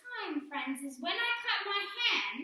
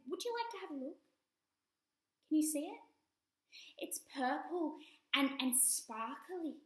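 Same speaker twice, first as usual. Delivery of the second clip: usual speaking voice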